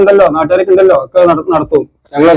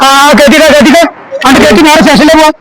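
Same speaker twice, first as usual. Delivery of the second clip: raised, higher officer's voice; stern ordering; 6 semitones higher than usual. Acted. Officer sends suspect to the station, stern.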